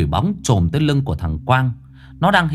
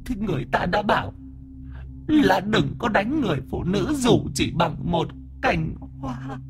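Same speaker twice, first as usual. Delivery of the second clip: whimpering; 9 semitones higher, 5 dB quieter than usual. Acted. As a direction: whine like a wounded lover. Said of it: "con quỷ bóng mếu máo".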